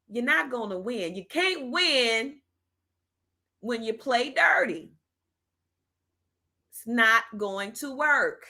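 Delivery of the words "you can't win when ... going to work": each word slowed and pressed, louder and higher; slow emphatic warning. Real, not acted. To vote cheaters, a slow emphatic warning. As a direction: lay it down slowly like a final verdict.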